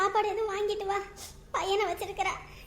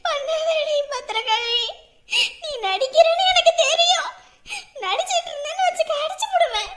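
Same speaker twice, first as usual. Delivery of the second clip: higher, tear-choked mock threat; crying; 5 semitones higher, 3 dB louder than usual. Husband crying at Pathirakali's body, sobbing threat. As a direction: scold her through sobs.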